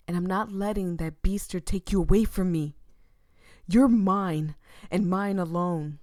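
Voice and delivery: loud, claiming growl; possessive snarl. Acted. Jealous boyfriend loudly claiming Voya as his. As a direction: stake your claim forcefully.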